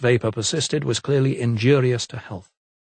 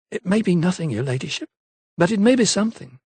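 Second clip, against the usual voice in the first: put-on higher, hesitant young servant girl; a lightly voiced London maid, unsure; maid's voice; 7 semitones higher than usual. A narrator's reading. Maid Eliza's voice.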